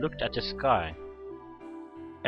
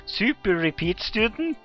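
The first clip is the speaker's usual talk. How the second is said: loud, high, slow incredulous echo; louder, higher astonished question; 11 semitones higher, 8 dB louder, slower than usual. Acted. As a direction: repeat it in drawn-out disbelief, voice rising sharply. Same question repeated, more incredulous.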